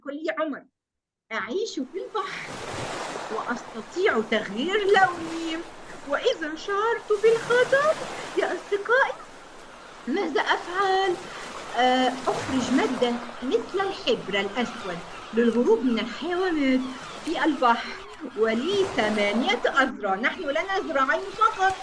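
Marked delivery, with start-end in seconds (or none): 1.3-11.2 high, lively octopus riddle reading; riddle storytelling. Acted. Teacher reads octopus riddle. 11.8-20.1 reading through a light laugh; laughing while reading. Real, not acted.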